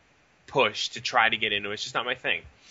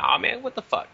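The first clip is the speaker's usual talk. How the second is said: playful cheer-up; 14 semitones higher than usual. Retold or imitated. Playing the cheerful clown at work.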